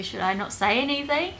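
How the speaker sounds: ordinary talk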